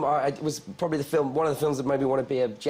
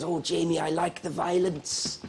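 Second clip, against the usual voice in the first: put-on Burmese accent; 5 semitones higher, slower than usual. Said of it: "I'm trying to do a Burmese accent".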